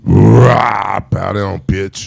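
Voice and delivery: loud, low devil growl. Retold or imitated. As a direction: snarl it like the devil. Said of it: "saying it in her devil voice".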